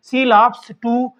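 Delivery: each English word spaced out very slowly; deliberate classroom dictation pace. Shown as a demonstration. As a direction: read it out slowly for students to write down. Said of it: "teacher dictating example sentence".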